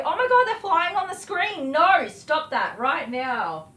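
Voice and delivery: loud, slowed stern stop to the bugs; alarmed, then a drawn-out firm no; real alarmed scolding. Real, not acted. Bugs flying on screen.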